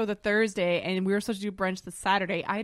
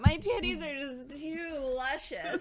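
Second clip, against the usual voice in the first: mock luxurious brag; 5 semitones higher, slower than usual. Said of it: "Her life is so hard".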